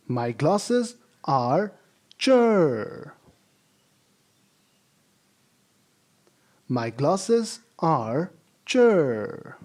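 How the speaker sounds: very slow reading ending on a falling "cher"; slow model sentence. Shown as a demonstration. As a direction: drill it slowly, landing on "cher". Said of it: "Repeat it after me".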